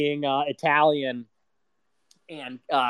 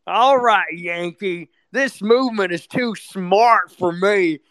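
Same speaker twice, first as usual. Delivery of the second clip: mocking a dim Southern yokel; mock Southerner; 5 dB louder than usual. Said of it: "the Southerners are gonna save us".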